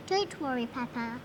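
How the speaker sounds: ordinary delivery, nothing marked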